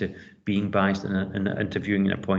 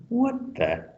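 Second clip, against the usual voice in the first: surprised; 11 semitones higher than usual. Real, not acted. Reacting to accent remark.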